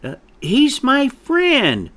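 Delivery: high, loud, drawn-out protest; quavering defiance. A narrator's reading. Chick stands up for his friend, defiant. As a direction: protest nervously, pitched right up. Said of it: "said Peck with quavering defiance".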